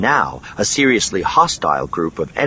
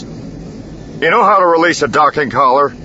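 higher, urgent snap; sharp, pitched-up demand; snapped question; 8 semitones higher, 3 dB louder than usual. A narrator's reading. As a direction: snap it at a crewmate. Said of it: "Han snapped at Elegos".

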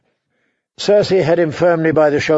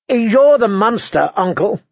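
put-on much higher, slow sneering boy king; 7 semitones higher than usual. A narrator's reading. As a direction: spit the insult back at his uncle. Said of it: "Joffrey sneered".

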